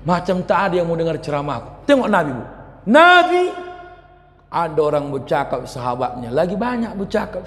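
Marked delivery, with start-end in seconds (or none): 2.8-3.4 exclaimed name. Real, not acted.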